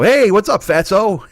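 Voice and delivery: put-on high, loud, shameless old-timer hail; blunt old person. Old person insulting a stranger, loud and high. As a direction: call it out like an old person who gets away with it. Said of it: "old people can go".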